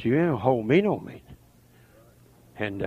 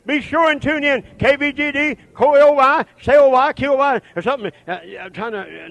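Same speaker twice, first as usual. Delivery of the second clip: ordinary talk, nothing marked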